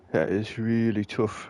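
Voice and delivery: slow, playful put-on British accent on "That is really tough". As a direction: slip into a mock British accent, unhurried. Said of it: "I don't know why I'm going British".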